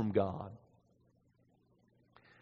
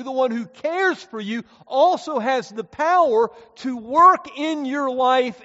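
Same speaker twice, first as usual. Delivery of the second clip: higher and much louder, ringing out; full-force preaching; 11 semitones higher, 11 dB louder than usual. Acted. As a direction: build the sermon to its climax. Climactic preaching.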